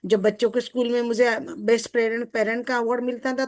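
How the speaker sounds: ordinary delivery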